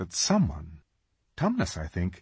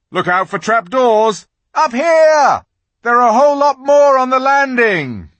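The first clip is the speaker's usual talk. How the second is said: high, loud excited cries; excited shouting; 13 semitones higher, 10 dB louder than usual. Acted. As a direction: call out across the whole castle. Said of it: "everyone's voices crying out at once".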